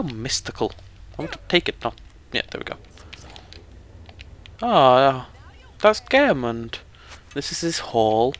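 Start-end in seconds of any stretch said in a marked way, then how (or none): none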